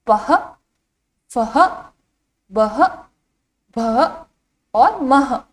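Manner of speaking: "पह, फह, बह, भह, मह" each apart with an h ending; visarga pronunciation. Shown as a demonstration. Teacher reciting the row for children, visarga pronunciation. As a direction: sound out "पह, फह, बह" and on, one at a time. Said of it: "इसका उच्चारण किस तरह से किया जाता है".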